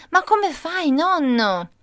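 amazed question. Retold or imitated. Child amazed at grandpa climbing.

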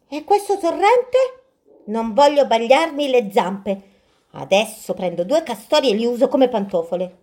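a spoiled bear afraid of wet paws; fussy bear. Acted. Bear at the stream.

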